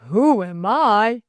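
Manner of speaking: slow, drawn-out mock question; slow teasing repetition; amused echo. A narrator's reading. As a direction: toss the challenge back, savouring each word. Amused master answers girl's challenge.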